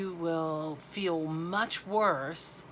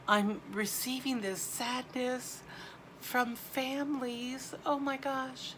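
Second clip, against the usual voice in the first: choked up, voice pitched higher; tearful, higher voice, halting with grief; real crying; 6 semitones higher than usual. Real, not acted. Medium receiving sadness from grieving families, crying. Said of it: "I'm crying".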